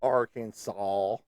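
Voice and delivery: "Arkansas" drawn out slowly, ending in "saw"; the proper local "Arkansas", spoken slowly; slow careful Arkansas. Shown as a demonstration. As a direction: say "Arkansas" slowly, every syllable clear. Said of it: "You say it".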